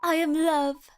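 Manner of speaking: grand storybook character, lightly put on; lofty, poetic proclamation; game character declaiming. Voices a game character's lines.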